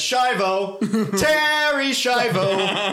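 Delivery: slow, high drawn-out answer; hammy slow reply. Retold or imitated. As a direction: drag it out like a cartoon. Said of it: "And to that he says".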